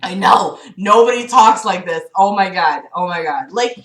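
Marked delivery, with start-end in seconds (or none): none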